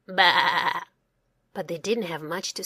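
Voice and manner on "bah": low bleating baa; sheep bleat. Acted. Sheep answers Sam.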